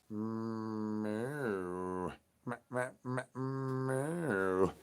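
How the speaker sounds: soft, quiet mewing cat; cat meow. Pretend. Cat answering the El Wushy call, soft meows. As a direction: mew back like a shy cat.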